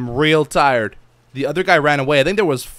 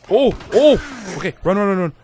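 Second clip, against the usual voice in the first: panicked, higher and louder; being chased; 7 semitones higher, 5 dB louder than usual. Real, not acted. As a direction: flee in a rush. Running from big natives.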